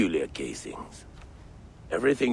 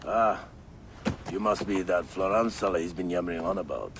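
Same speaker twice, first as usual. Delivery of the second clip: ordinary delivery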